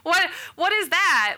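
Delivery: what even is that, said through a laugh; confused laugh at a strange diagnosis; baffled and laughing. Retold or imitated. Retold confusion at unknown diagnosis.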